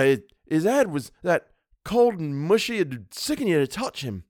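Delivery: high-pitched, animated Cockney storyteller; Cockney dialect. A narrator's reading. Servant telling his employer of the scrap, in lively Cockney. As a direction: tell it as an indignant Cockney lad. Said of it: "Thomas's Cockney dialect".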